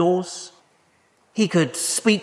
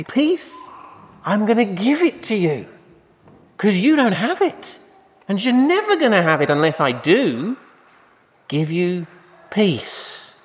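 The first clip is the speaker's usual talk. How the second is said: higher, earnest, breaking in; lightly voiced Jesus; 5 semitones higher than usual. Jesus breaking through the door, earnest higher voice. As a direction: insist gently but firmly.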